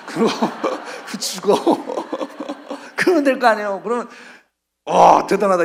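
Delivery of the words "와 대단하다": loud amazed exclamation; amazed onlookers. Retold or imitated. Crowd marveling at Jesus coming down, loud amazement. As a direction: gasp it out in loud wonder. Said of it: "이럴 거 아니에요".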